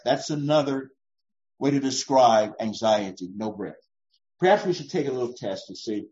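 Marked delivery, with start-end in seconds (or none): 3.4-3.8 No breath echoed much softer and lower; a hushed repeat of No breath. Shown as a demonstration. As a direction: let No breath fall away quietly.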